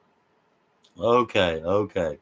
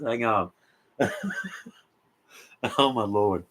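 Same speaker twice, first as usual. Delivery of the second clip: normal talking voice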